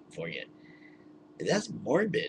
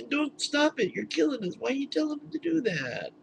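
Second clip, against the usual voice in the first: much higher, giddy mock angel voices; laughing angels; 13 semitones higher than usual. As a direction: squeal like angels cracking up. Heavenly hosts laughing, voiced.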